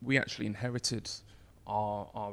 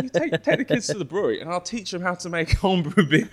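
laughing; 9 semitones higher, 12 dB louder than usual. Real, not acted. Laughing at the absurd rule.

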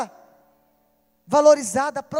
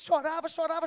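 ordinary talk, nothing marked

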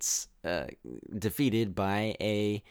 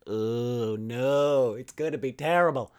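drawn-out, rising "oh no" of dread; mock dismay; 3 semitones higher, 3 dB louder, slower than usual. Viewer reliving first sight of the fighting Amazons, hammed-up dismay. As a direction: groan it upward in exaggerated despair.

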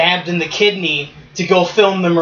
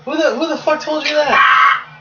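mock outrage; 9 semitones higher than usual.

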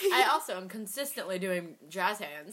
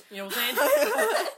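higher, louder hype-man repeat; excited hype; 13 semitones higher, 7 dB louder than usual. Pretend.

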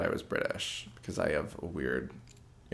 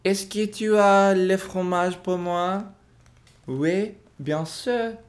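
put-on higher, slow French spoken with an English accent; 11 semitones higher, slower than usual. Guest acts out asking for cheese in French with an English accent. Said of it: "When I speak French, I speak kind of with an English accent".

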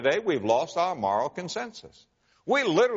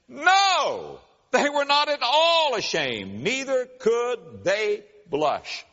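preaching; 8 semitones higher, much slower than usual. Acted. Preaching scripture at full force.

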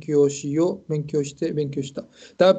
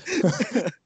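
self-amused chuckle, pitched up; higher, amused giggle; laugh; 5 semitones higher than usual. Real, not acted. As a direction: laugh at yourself. Laughing at own remark.

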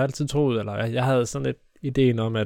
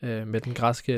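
ordinary talk

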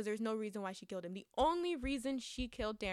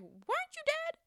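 much higher incredulous question; mock disbelief; 8 semitones higher than usual. Mocking a character who came back, high incredulous squeal. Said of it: "I'm like".

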